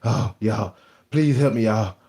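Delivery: faked tired plea for help; mock pleading. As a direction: beg for help, hammed up. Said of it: "I supposed to been the one saying".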